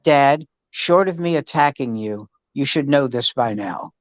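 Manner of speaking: low, deadpan imitation of a fed-up daughter; grumpy daughter. Daughter telling dad she hates mornings, imitated grumpiness. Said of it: "Why are you so grumpy".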